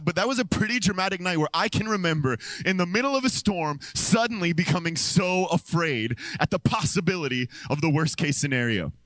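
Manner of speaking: usual speaking voice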